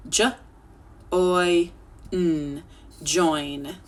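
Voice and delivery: each sound drawn out separately; J, oi, n, then join blended; stretched sounds. Shown as a demonstration. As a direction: sound it out slowly, piece by piece. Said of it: "Let's stretch it".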